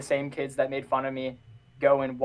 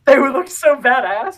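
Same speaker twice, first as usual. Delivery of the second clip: excited; 11 semitones higher, 13 dB louder than usual. Real, not acted.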